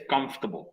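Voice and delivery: "comfortable" as COMF-tuh-bul; common pronunciation. Shown as a demonstration. Teacher giving the most common pronunciation, comfortable in three syllables. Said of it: "una pronunciación más común".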